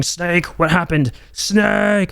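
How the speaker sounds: high-pitched cry over the radio, imitated; hammed-up, high death-scene cry; panicked comrade. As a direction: call out in mock alarm for a fallen comrade. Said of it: "your comrades going".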